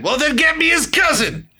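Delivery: high, loud quote broken by laughter; laughing movie quote. Retold or imitated. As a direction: blurt the line out while cracking up. Retelling a movie line, laughing.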